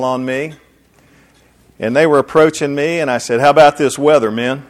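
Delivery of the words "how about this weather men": loud, gruff greeting to the marines, man to man; hailing two jogging marines in a put-on manly voice; lowered manly voice. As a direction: play the tough old officer, booming. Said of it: "had to lower my voice".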